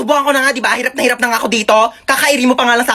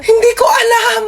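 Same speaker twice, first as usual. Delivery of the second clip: crying shout; 10 semitones higher, slower than usual. Acted. Dead man answers mourner.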